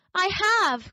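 high indignant little rabbit; sudden high protest; indignant cry. A narrator's reading. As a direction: blurt it out, stung. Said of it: "cried the little rabbit".

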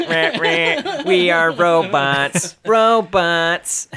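slow, loud mechanical robot voice. Pretend. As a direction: drone it out like a robot. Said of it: "We were robots the entire time".

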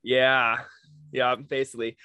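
normal talking voice

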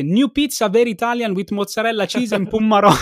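loud, high fake American accent. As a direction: ham up an American tourist. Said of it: "ci dice".

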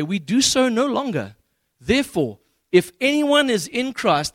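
slow, emphatic pulpit reading; scripture reading. Acted. Preacher reads Corinthians to the congregation, slow and emphatic. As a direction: read deliberately, leaning hard on key words.

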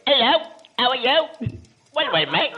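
high, loud, hammed-up British greeting; mock British accent. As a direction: call out like a caricature British chap. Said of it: "I don't hear any South Africans going".

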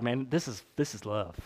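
soft, low, hushed awe; dad amazed by his child's love; awed. Retold or imitated. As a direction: breathe it like a revelation. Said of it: "you're like".

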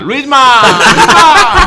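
teasing yell. Retold or imitated. Mocking a curly-haired guy at a bar with a laughing yell. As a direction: call out "Luisma" up high, building into a laugh. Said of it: "le empezaste a gritar".

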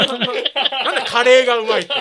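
repeats it while laughing. Shown as a demonstration. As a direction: laugh through the question. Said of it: "何の質問なんですか". (笑いながら繰り返す)

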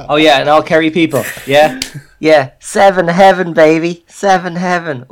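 loud, hammed-up Dublin accent. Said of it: "you went very dub there".

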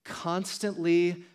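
ordinary talk

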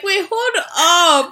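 high, loud mock outrage; stretched-out, disbelieving protest; Connor. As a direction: cut in like a wronged bystander. Acts out Connor's shocked protest.

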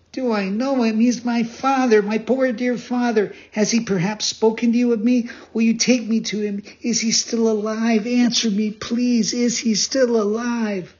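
high-pitched, urgent pleading; eager, anxious puppet boy. Acted. Pinocchio begging the pigeon for news of his father, high and urgent. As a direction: pile up the questions in a high child's voice.

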